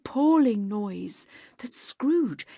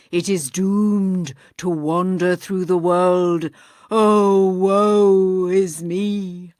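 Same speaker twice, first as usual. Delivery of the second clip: doomed ghost; 7 dB louder than usual. Acted. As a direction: moan out the ghost's doom. Voices ghost lamenting.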